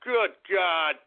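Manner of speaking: ordinary delivery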